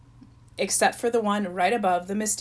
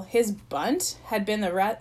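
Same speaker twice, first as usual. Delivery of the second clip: ordinary delivery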